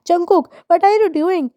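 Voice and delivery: high startled cry of panic; wife startled awake in his arms, panicked. A narrator's reading. Pregnant wife lifted up suddenly, high panicked question. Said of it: "She panicked".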